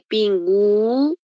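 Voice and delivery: "pingüino" slowed down, lingering on the U before the I; drawn-out sounded U. Shown as a demonstration. Teacher making students notice the vowels, slow "pingüino" with long U. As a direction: stretch the U in "pingüino", pin-guu-wee-no. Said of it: "ahí sí suena la U y la I".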